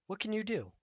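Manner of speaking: high, mechanical robot voice. Acted. Robot toy asks the new rabbit, in a high robot voice. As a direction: play the shiny toy robot asking.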